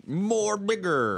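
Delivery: high, slow booming announcer parody; hammed-up drawn-out tagline; mock promo slogan. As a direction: stretch it out like a goofy slogan.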